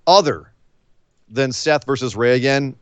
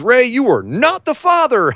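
mock talk-show reveal; 10 semitones higher than usual. Mock Maury paternity reveal.

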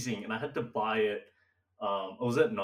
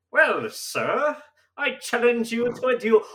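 higher, louder pompous duel challenge; old-timey gentleman; 11 dB louder than usual. Pretend. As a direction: challenge him like a stuffy old-timey gent. Voicing a 1920s gentleman's challenge.

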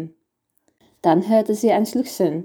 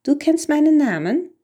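higher-pitched, astonished question; surprised; 7 semitones higher than usual. A narrator's reading. Toinette to the little man who knows her name, surprised. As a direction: read it as mild surprise, lifting the pitch. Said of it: "Toinette schaute überrascht".

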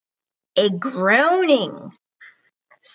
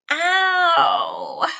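pained cry; 6 semitones higher than usual. Acted. Nana knocked over cries out.

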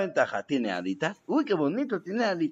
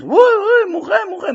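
high, loud frightened yelp; panicked plea; 7 dB louder than usual. Retold or imitated. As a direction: yelp in fright at his wife. Fleeing wife's broom.

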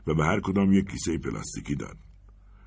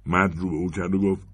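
ordinary delivery, nothing marked

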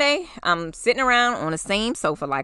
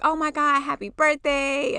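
gushing; 6 semitones higher than usual. Retold or imitated. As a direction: gush over the birthday news. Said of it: "they're like".

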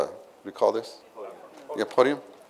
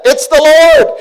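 high, loud call of recognition; John crying out to Peter; excited cry; 13 semitones higher, 13 dB louder, slower than usual. Retold or imitated. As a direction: shout it across the boat. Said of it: "John said".

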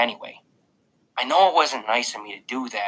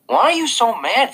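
put-on boy asking; 12 semitones higher, 4 dB louder than usual. A narrator's reading. Jack asking.

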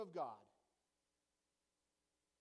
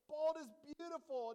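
proud declaration; 6 semitones higher, 6 dB louder than usual. Real, not acted. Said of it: "bald was beautiful".

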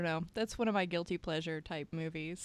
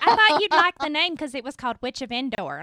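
mocking mom voice; 6 semitones higher, 11 dB louder, faster than usual. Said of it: "She's like".

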